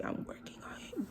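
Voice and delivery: voiceless, confiding whisper; whispered aside. Real, not acted. Storyteller admitting her bad trait, whispering. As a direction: drop to a whisper for a confession.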